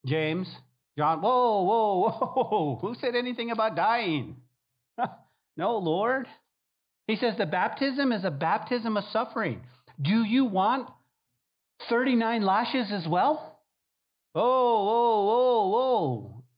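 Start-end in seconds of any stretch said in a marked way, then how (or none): 0.1-4.4 mock alarmed disciples. Disciples balk at death. 14.3-16.4 higher, louder panicked whoas; mock alarm. Disciples balk at lashes.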